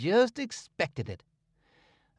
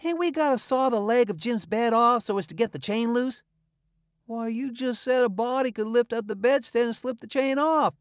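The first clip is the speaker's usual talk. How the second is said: exasperated Tom; 8 semitones higher than usual. A narrator's reading. Tom exasperated with Huck's simple ideas. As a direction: scold his friend's dullness.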